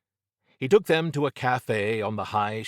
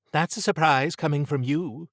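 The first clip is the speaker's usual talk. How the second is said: higher-pitched woman's character voice; lifted, higher voice for Trish; Trish's surprised retort; 7 semitones higher, 3 dB louder than usual. A narrator's reading.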